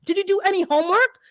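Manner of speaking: high, pressing rapid questions; scolding a neighbour about the lawn spraying; exasperated yelling. Retold or imitated. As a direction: grill her with one angry question after another. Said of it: "after I was yelling for a bit".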